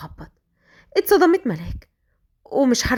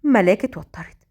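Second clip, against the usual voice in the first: plain reading voice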